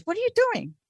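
imitated Ghanaian coach's accent, pitched high; coach's accented question, voice leaping up high; broken accent. Coach walks over to a practicing player, high broken accent. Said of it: "Like that".

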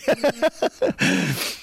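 short high cackle; laughing. Real, not acted. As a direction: giggle high at your own quip. Laughs at own joke.

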